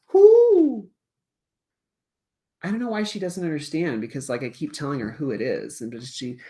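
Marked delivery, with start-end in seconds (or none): 0.1-0.9 high, loud owl hoot; Snowy. Acted. Sleeping owl answers the teacher, high loud hoot. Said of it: "eventually Snowy will say".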